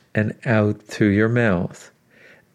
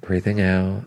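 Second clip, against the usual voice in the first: guided breathing cues; 3 dB quieter than usual. Acted. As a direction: count the breaths gently, unhurried.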